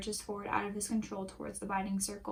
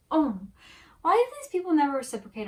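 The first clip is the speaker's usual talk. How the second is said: exasperated; 7 semitones higher, 10 dB louder, faster than usual. Real, not acted. Fan rant about writing.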